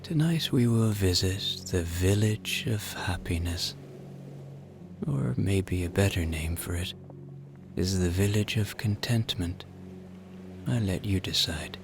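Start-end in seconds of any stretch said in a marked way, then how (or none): none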